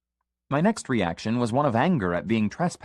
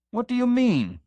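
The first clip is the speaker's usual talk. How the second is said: sharp, much higher alarmed cry; cried out. A narrator's reading. Father startled by the accusation, crying out high. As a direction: blurt it out in alarm. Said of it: "I cried".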